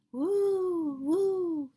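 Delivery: rising ghostly woo of the wind; wind howl. A narrator's reading. Wind sound in story.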